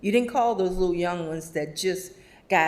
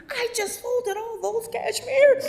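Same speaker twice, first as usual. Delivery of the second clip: put-on much higher, affronted old lady; indignant Sherry. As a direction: scold the customer in a high voice. Sherry scolding a customer.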